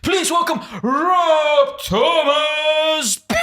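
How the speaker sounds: high, very drawn-out guest introduction; hyped announcer. Pretend. Host welcomes the expert guest, hyped announcer. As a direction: shout out the name, stretching each word.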